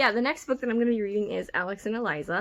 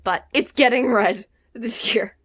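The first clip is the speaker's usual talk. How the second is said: determined vow; 9 dB louder than usual. Real, not acted. Vowing to read the book.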